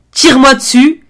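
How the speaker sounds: loud shouted order; shouting. Retold or imitated. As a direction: yell it out. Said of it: "et je lui dis".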